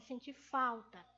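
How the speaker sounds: ordinary talk, nothing marked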